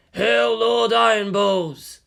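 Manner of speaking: mock-solemn repeat; echoing hail. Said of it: "Toji echoed".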